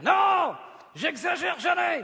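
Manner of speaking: high, indignant shouted denial; shouting. Acted. Puppet denying he ever exaggerates, shouting indignantly. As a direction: yell the denial, stretched out. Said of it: "Mais arrêtez de crier".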